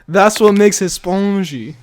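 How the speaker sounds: hammy fake French accent, slow; terrible French accent. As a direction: slowly ham up a French accent. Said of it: "That was a terrible French accent".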